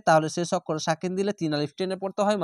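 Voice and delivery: ordinary delivery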